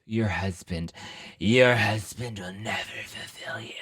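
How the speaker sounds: slow, creepy whispered prophecy; eerie whisper. Retold or imitated. Medium warns Camille at the dinner party, eerie whisper. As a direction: hiss it like a spooky medium.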